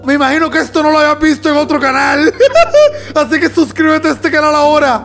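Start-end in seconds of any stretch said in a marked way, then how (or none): 2.3-3.1 high-pitched giggling burst; high laugh. Real, not acted. Laughs after boasting of the video.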